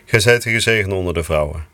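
low, even murmured Hail Mary; prayer recitation. Real, not acted. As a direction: recite the prayer steadily, devoutly.

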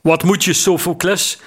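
gruff call from the roof; called from above. A narrator's reading. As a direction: shout the question down from the deck. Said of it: "riep een stem op het dak".

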